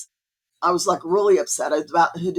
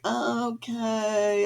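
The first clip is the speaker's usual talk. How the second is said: drawn-out, higher, doubtful okay; sceptical; 4 semitones higher, 4 dB quieter than usual. Retold or imitated. Patient answering her doctor's odd advice, retold as sceptical. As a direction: stretch it out, humouring someone who seems crazy. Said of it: "thinking she was crazy".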